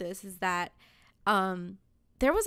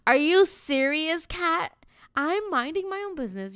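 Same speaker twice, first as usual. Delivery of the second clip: exasperated rant; 7 semitones higher, 3 dB louder than usual. Retold or imitated. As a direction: scold the cat, stretching each word in disbelief. Venting at swiping cat.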